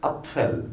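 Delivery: "Apfel" with the P-F cluster articulated; every letter sounded. Shown as a demonstration. Teacher repeating the example word, every letter sounded. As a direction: model "Apfel" with no silent letters. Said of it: "Toutes les lettres se prononcent".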